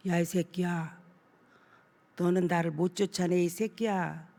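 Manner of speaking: low, soft, menacing demon voice; lightly voiced demon taunting the pastor; possessed man's sneer. Possessed youth cursing the praying pastor, low sneering demon voice. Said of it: "입을 확 이렇게 삐죽거리면서".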